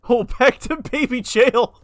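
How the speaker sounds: loud, high, laughing through the words; amused high-pitched outburst. Real, not acted. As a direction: crack up while saying it. Laughing at being sent back.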